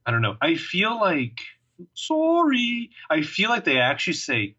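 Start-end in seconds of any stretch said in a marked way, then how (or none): 1.9-2.9 Canadian sorry. Retold or imitated. Host joking about Canadians saying "Sorry." in a high mock Canadian voice. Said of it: "Is that a Canadian joke?".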